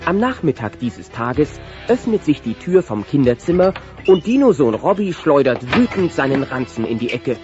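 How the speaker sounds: usual speaking voice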